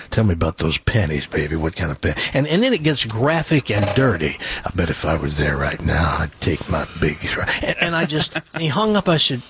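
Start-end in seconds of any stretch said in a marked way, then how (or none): 0.1-2.1 much lower, crooning late-night newsman; deep newsman voice. Retold or imitated. Newsman on the phone sweet-talking women, very low croon. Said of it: "It was beyond deep". 4.6-7.2 much lower, crooning late-night newsman; deep newsman voice. Retold or imitated. Newsman on the phone sweet-talking women, very low croon. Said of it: "It was beyond deep".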